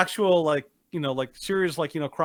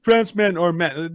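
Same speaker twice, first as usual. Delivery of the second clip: mocking a slogan, louder; 2 semitones higher, 6 dB louder than usual. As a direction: declaim it like a smug slogan. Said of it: "the N H L's response".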